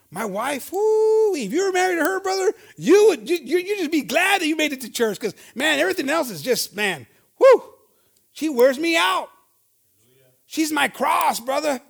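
hammed-up husband griping about his wife; exasperated husband. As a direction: throw up your hands about the wife. Husband complains, acted out.

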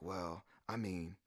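quiet, low hesitant reply, dragged at first; sheepish hedging. Retold or imitated. Retelling friend's answer when challenged, sheepish and hesitant. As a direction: imitate a cornered friend hedging softly.

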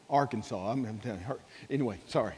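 normal talking voice

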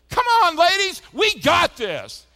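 high, loud shout across the gym; rally the whole team from the court. Retold or imitated. Samantha shouting to her losing team, a high loud rally cry. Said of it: "I hear Samantha's voice say".

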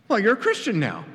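high, chipper brush-off; breezy evangelist. Acted. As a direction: wave the doubt away cheerfully. Evangelist answers the doubter.

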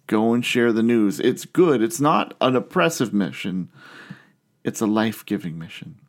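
ordinary talk

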